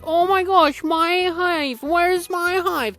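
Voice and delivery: high squeaky mock-sobbing bee; crying bee voice. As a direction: play a distraught bee begging for her hive. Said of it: "She's crying".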